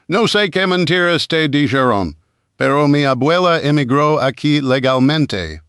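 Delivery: fluent native Spanish; calm correction in flawless Spanish. A narrator's reading. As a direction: speak it like a native Spanish speaker. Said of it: "in flawless Spanish".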